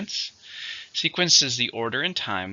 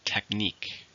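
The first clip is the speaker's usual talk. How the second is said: technique with the second syllable "-nique" stressed; teacher says technique, leaning on "-nique"; 4 dB quieter, slower than usual. Shown as a demonstration. Model the word for students, stress on "-nique". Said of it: "stress on the second".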